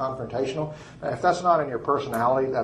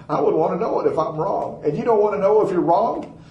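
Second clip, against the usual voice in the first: retold disbelief, rising higher and louder; incredulous challenge; 7 semitones higher, 5 dB louder than usual. Preacher retelling his challenge to a scientist, incredulous. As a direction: push the question up in mock astonishment.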